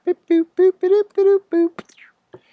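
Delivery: playful high boop sound effects; mock boops. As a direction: chirp out little boops as you type. Sound effects while typing code.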